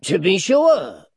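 slow, high, loud swoop; drawn-out, sly assent; dwarf's mock agreement. A narrator's reading. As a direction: agree with exaggerated readiness. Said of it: "rejoined Daniel".

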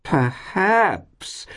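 very high, drawn-out swoop; squealed landlady delight; ecstatic cry. A narrator's reading. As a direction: cry the word out in rapture. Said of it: "cried Missus Todgers ecstatically".

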